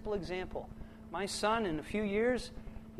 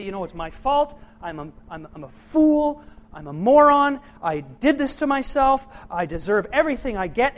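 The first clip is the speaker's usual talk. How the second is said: louder, rising self-loathing rant; self-blaming outburst; 15 dB louder than usual. Retold or imitated. Someone blaming themselves for their pain, loud self-reproach. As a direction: berate yourself as a fool. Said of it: "they get mad at themselves and they say".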